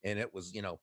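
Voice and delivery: ordinary talk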